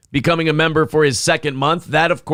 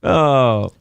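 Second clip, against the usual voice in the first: ordinary delivery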